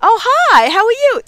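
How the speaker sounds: imitating staff, high and bright; cheerful staff greeting. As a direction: mimic the attendants' happy hello. Parking attendants greeting her warmly.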